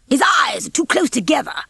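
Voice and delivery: put-on high, indignant old rooster voice; disapproving old rooster. A narrator's reading. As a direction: complain indignantly about him.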